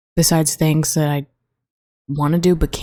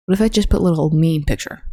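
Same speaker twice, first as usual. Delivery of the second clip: ordinary delivery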